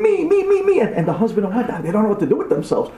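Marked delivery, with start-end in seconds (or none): 0.0-0.8 put-on high, rapid clamour of a child; high-pitched eager cry; kids screaming. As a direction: squeal it like a kid fighting to be chosen. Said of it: "each kid is screaming".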